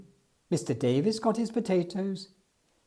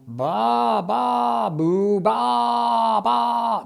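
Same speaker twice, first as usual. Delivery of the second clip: higher, louder chorus of sheep cries; shouted bleating; 6 semitones higher, 4 dB louder, much slower than usual. Acted. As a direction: bleat loudly together like a flock. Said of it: "they shouted".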